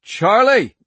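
loud, desperate yell of her name; urgent shout. A narrator's reading. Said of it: "His voice cracked and urgent".

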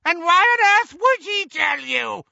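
put-on very high, loud old-woman screech; piercing, screeched question. A narrator's reading. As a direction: screech it in scornful disbelief. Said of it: "screeched Muriel".

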